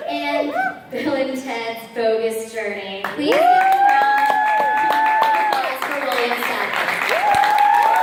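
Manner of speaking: slow, loud stage announcer; announcer introduction. Real, not acted. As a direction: build to a big call for applause. Introduces the guest to crowd.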